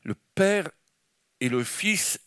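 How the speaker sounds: normal talking voice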